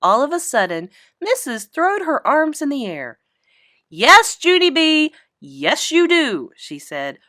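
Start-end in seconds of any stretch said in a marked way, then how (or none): 3.9-6.5 loud, slow, fed-up teacher; exasperated teacher. Acted. Teacher losing patience with Junie B, loud and slow. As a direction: at the end of her rope, spell it out. Missus exasperated, arms in air.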